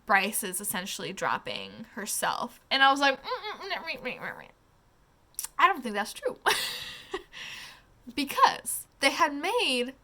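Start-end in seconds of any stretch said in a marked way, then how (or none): 3.2-4.5 sceptical humming. Retold or imitated. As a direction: hum your disbelief, mm mm mm. Doubting the book's claim.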